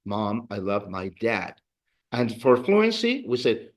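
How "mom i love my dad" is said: slow model. Shown as a demonstration. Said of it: "we translated it as".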